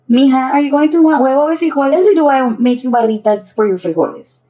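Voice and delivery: loud, high, lilting motherly question. Acted. Mother asking daughter about breakfast, motherly calling voice.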